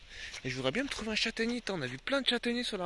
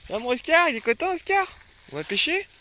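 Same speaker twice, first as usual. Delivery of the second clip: high sing-song voice for the dog; doggy talk; 9 semitones higher, 7 dB louder than usual. Real, not acted. Talking sweetly to his dog.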